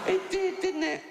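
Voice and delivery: can't believe what she wrote; incredulous retort. Real, not acted. Comedian to audience, high incredulous squeak.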